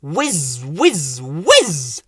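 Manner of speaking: rising-and-falling whizzes; whizzing sound. A narrator's reading. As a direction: swoop each whiz like speeding past. Story noise of running round.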